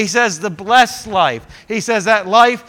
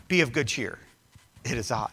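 gentle reassurance; 7 semitones lower, 7 dB quieter than usual. Acted. Jesus calms terrified disciples.

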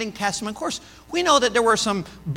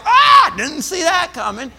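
mock surprise; 6 semitones higher, 8 dB louder than usual. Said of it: "we're like".

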